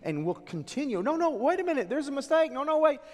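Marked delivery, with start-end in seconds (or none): 1.0-3.0 high-pitched pleading objection; panicked protest. Acted. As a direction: protest urgently as if wrongly condemned. Condemned people protesting their judgement.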